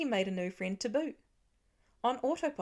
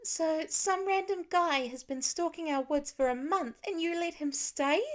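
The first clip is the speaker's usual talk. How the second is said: angry; 8 semitones higher than usual. A narrator's reading. Lila confronts her parents, angry and accusing. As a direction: demand it of the parents in anger. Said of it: "Anger overtook Lila's face first".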